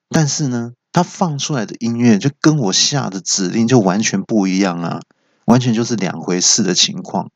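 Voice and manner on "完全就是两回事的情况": usual speaking voice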